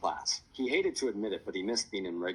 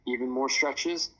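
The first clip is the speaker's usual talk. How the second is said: ordinary delivery, nothing marked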